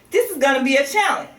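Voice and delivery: usual speaking voice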